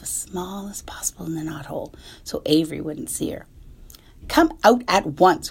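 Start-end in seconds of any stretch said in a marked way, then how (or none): 4.3-5.5 loud, slow stern mother; scolding cry. Acted. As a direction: bark the order. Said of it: "cried Missus Arable".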